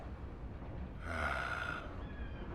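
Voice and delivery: long, soft breath out; regretful sigh. Acted. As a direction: let out a long quiet sigh. Admitting a mistake after an argument.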